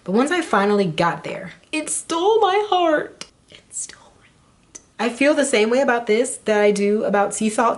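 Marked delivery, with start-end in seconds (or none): 1.7-3.3 swooning. Acted. Swooning over highlighter. 3.5-4.8 whisper. Acted. Whispered repeat of line.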